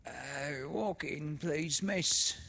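put-on high, loud, fawning old woman. A narrator's reading. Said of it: "the woman answered".

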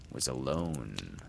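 ordinary delivery

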